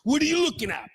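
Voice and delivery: hammed-up bar challenge; mock tough-guy challenge. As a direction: pick a fight with each patron.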